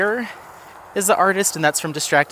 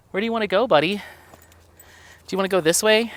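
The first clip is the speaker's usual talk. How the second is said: higher, coaxing voice for the dog; talking to the dog; 6 semitones higher, much faster than usual. Real, not acted. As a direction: ask the dog sweetly where to go. Talking to her dog on walk.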